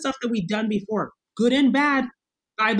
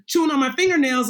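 ordinary delivery

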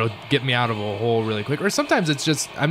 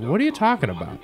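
incredulous retort; 7 semitones higher than usual. Retold or imitated. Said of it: "And I was like".

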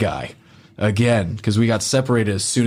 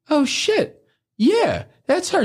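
much higher, stunned oh-shit; seeing her photo from a friend, high and shocked; startled recognition; slower than usual. Retold or imitated. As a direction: gasp as it clicks.

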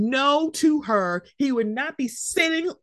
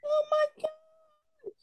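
shocked; 6 dB quieter, much slower than usual. Real, not acted. Shocked at sisters feuding.

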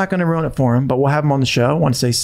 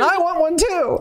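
wistful whine. Real, not acted. To co-host, a very high mock whine. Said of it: "wistful joke".

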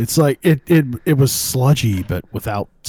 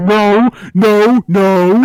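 mock panic; 9 semitones higher, 9 dB louder, much slower than usual. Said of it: "afraid that you'll upset some fucking genre alien".

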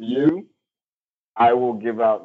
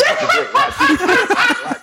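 gleeful teasing; 4 dB louder, much slower than usual. Retold or imitated. Teasing friend during movie.

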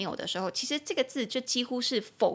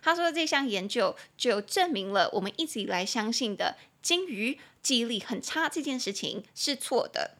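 ordinary talk, nothing marked

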